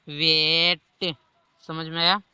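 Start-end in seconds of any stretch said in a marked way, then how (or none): 0.1-1.1 वेट drawn out slowly, each sound clear; teacher stretching वेट for students; slow demo word. Shown as a demonstration. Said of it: "जब दो consonant के बीच ए हो".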